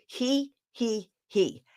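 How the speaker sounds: little tittering fake laugh; soft fake giggle. Shown as a demonstration. Showing parents the second laugh, soft forced giggle. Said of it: "turn your hands and go".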